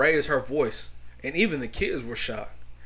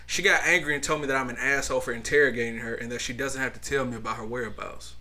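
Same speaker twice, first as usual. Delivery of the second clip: normal talking voice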